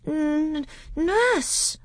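put-on tiny, very high frightened child; scared little boy's halting confession; faltering. A narrator's reading. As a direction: stammer it out on the edge of tears. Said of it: "faltered Caspian".

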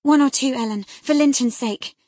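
high, loud supplication; earnest pleading. A narrator's reading. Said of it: "She earnestly supplicated".

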